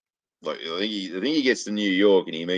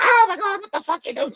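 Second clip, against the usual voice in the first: squealing high falsetto outrage; shocked woman; 8 dB louder than usual. Retold or imitated. Groped party guest shrieks at him in high falsetto. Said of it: "and she's like".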